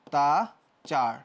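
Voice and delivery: অত্যাচার drawn out very slowly as "ottachar"; teacher sounding out অত্যাচার slowly; slow pronunciation demo. Shown as a demonstration. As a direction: stretch অত্যাচার slowly with an o at the start. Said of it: "একটু ভুল হয়ে গেছে".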